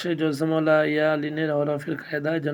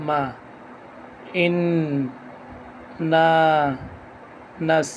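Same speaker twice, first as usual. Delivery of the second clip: measured syllable-by-syllable Quran drill; broken-up syllables; slower than usual. Shown as a demonstration. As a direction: recite one syllable, stop, breathe, then the next. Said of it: "ভাঙ্গা ভাঙ্গা উচ্চারণ করব".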